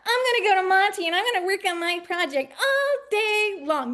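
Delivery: high, loud mocking of an overconfident student; mock student boast. Mocking a student's all-day plan, high and loud. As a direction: parody the kid who swears they'll work all day. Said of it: "You're like".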